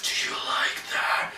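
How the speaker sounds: slow, breath-only eerie whisper; imitated whisper. Host mimics the singer's whispered line, slow whisper. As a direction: whisper it like a haunting vocal line.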